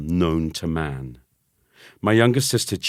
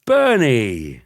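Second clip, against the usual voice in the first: loud, forced bright greeting; forced brightness; 5 semitones higher, 6 dB louder than usual. A narrator's reading. Boy greeting cousin with loud forced brightness. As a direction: sound cheerful when you are not. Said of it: "as brightly as I could".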